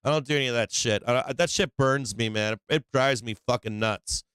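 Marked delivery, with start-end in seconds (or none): none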